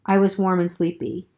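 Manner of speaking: usual speaking voice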